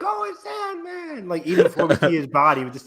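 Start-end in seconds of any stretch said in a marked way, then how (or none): 0.0-1.3 high, drawn-out excited cry; crowd going wild. Retold or imitated. Thursday crowd spotting Sandman, high excited cheer. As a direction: imitate thrilled fans, stretched out slow and high. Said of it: "my Thursday crowd went wild at that".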